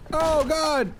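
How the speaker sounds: high, sudden oh God; startled cry. Real, not acted. Startled by something in the dark, high cry. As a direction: jump at something scary.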